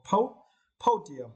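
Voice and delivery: high, isolated "po-", then "podium"; stress on first syllable. Shown as a demonstration. Teacher modelling a noun for learners, first syllable of "podium" stressed. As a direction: hit the PO in "podium". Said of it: "you need to stress the first syllable".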